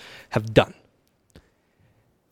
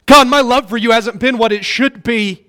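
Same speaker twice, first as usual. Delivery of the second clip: high, loud, urgent preaching as God; 7 semitones higher, 4 dB louder than usual. Acted. Preacher speaking as God to the congregation, high and loud. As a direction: plead with them, pushing hard. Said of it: "And God says".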